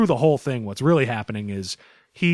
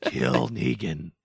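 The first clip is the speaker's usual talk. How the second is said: deep slow growl; 7 semitones lower, slower than usual. Pretend. Demanding Negan's death.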